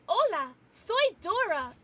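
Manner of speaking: high, bright cartoon kid greeting "Hola, soy Dora". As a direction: chirp it like a cartoon explorer girl. Dora the Explorer impression.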